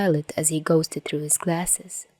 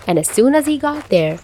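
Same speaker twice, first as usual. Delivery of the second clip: ordinary delivery, nothing marked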